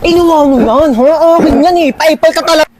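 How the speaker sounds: whiny angry complaint. Acted. Sister scolding her sibling for taking her things, high and whiny.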